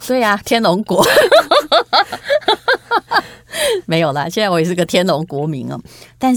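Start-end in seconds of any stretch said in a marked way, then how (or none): 1.1-3.2 high burst of laughter. Real, not acted. Laughing at Tianlong joke.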